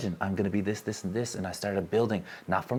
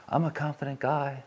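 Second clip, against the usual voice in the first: a higher-pitched, puffed-up show of bravado; fake confidence; 6 semitones higher than usual. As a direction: pretend to be a confident guy, slightly hammed up. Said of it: "I could try and fake it".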